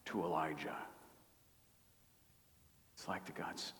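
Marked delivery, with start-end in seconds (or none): 0.0-0.8 soft, dropping to a near whisper; hushed, still small voice; low whisper. Shown as a demonstration. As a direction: let the voice fade to a hush. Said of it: "the sound of a low whisper".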